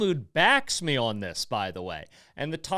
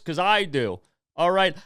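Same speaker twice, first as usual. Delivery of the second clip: ordinary talk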